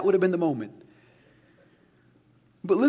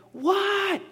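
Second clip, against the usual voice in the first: much higher shouted reply; mock yell back; 14 semitones higher than usual. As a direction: yell back like an annoyed kid. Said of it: "I'd yell back".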